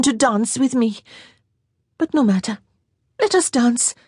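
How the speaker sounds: put-on girlish teasing; teasing young lady. A narrator's reading. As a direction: tease him, a young girl's voice. Girl teasing voice.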